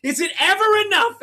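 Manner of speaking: loud, very high mock outburst; hammed-up exasperated wail of complaint; launching back in. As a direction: jump straight back into the imitated rant. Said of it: "launch back into it".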